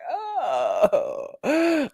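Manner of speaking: long, high-pitched "oh" of secondhand embarrassment; cringing groan. Real, not acted. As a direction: wail it out, squirming with cringe.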